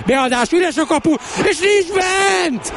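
rising excited commentator shout. Real, not acted. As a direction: yell the near miss. Goal chance, commentator shouting.

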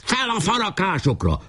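high-pitched rallying order; battle command. Acted. Pásztor ordering his men to climb, battle command. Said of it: "Pásztor újra parancsot adott".

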